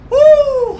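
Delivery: very high, loud triumphant whoop; real victory cheer. Real, not acted. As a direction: let out a big cheer. Winning fight.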